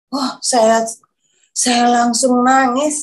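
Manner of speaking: ordinary delivery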